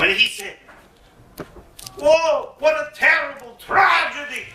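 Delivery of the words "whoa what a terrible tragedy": higher, louder overdone pity; mock sympathy. Bruno faking sorrow to his fooled friend. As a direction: pretend to be shocked for him.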